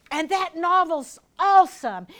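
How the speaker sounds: loud, drawn-out mock enthusiasm; enthralled reader. Speaker plays a reader hooked on a novel, enthralled. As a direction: gush slowly and loudly over the book. Acts out being absorbed in reading.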